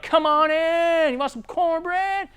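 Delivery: high, slow welcoming Southern host; Southern hospitality. Retold or imitated. Homeowner in the South inviting the salesman in, high and slow. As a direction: drawl it out like a friendly Southern homeowner. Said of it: "they're like".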